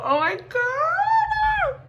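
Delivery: high, loud, drawn-out shriek of joy; shrieking jackpot winner. Real, not acted. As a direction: scream it like you just won. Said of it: "could not be happier right now".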